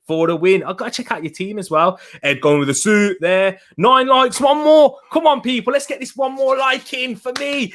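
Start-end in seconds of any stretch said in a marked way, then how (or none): 3.8-7.7 excited plea. Real, not acted. Begging viewers for one more like.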